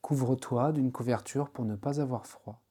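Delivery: ordinary talk, nothing marked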